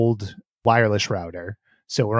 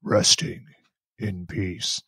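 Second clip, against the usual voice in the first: Undertaker whisper; 6 semitones lower, 6 dB quieter, much slower than usual. Retold or imitated. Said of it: "as The Undertaker would say".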